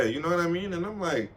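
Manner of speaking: ordinary delivery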